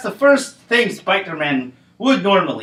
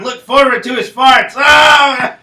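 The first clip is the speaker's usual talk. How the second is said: shouted laugh; 6 semitones higher, 6 dB louder than usual. Acted. Punchline then Hulk shouts.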